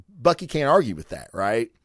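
ordinary talk, nothing marked

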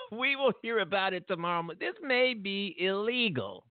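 high-pitched, laughing incredulity; real laughter pushing the voice up; laughing in disbelief. Real, not acted. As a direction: laugh through it, voice climbing high, dragging out the last words. Laughs at possible illegality.

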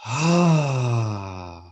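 long audible releasing exhale; dramatic sigh. Shown as a demonstration. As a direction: sigh it all out.

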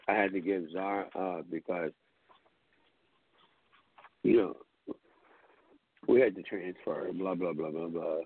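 a hint of a low mumbling friend; lightly imitating Joe. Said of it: "And he goes".

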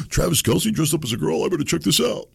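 high, excited gasp of a gossip-hungry viewer; mock eager viewer. Host mocks a gullible viewer, high and excited. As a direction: ham up a viewer hooked by clickbait.

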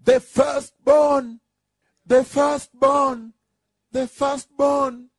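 high, drawn-out proclamation; insistent high-pitched shouted call; shouting. Acted. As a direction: cry it out like a herald, each call stretched. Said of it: "You now hear him shouting".

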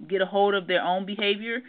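ordinary talk

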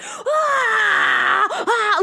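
high punk rock scream; death scream. Retold or imitated. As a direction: let out a dying punk scream. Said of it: "like, the death scream".

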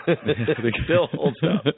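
laughing, slow, high-pitched repeat; amused slow repeat of the cohost's line; laughing echo. Real, not acted.

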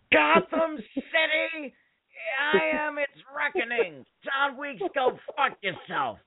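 very high, strained mock Gottfried rasp, slow and laughing; Gilbert Gottfried Bane. Imagining Gilbert Gottfried cast as Bane, shrill mock threat. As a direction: squawk the villain threat like Gottfried. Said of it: "have had Gilbert Gottfried play Bane".

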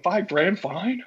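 slow, high rising disbelief; incredulous. Retold or imitated. Pitcher hearing his fine, slow incredulous question. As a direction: stretch it out in disbelief. Fined five grand.

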